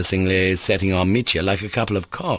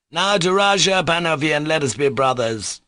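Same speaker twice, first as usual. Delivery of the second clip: rousing toast; 8 semitones higher than usual. A narrator's reading. Mitya toasts again.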